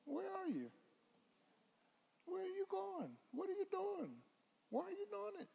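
high, searching questions; God's inquiring voice. Retold or imitated. God questioning his people, high and searching. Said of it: "God is gonna ask you".

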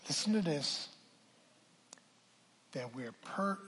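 ordinary talk

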